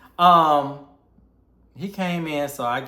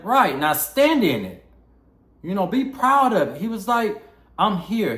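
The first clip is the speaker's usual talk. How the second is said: proud uncle; 10 semitones higher, 7 dB louder than usual. Retold or imitated.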